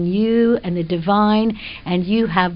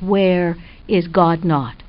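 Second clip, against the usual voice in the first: "Where is God not?" slowed, landing on "not"; stress on not; 2 semitones lower, slower than usual. Shown as a demonstration. Quoting Nachmanides to the host, slowly stressing the last word. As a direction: lean slowly into the final "not". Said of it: "The question is".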